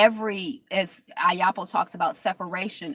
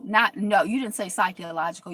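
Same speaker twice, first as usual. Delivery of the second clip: ordinary talk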